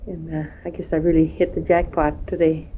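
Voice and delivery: normal talking voice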